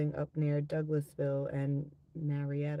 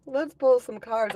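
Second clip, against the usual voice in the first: louder, higher, breaking out of a laugh; amused outburst; laughing noise; 8 semitones higher, 9 dB louder than usual. Real, not acted. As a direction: burst out with it after a laugh. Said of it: "I made that noise because".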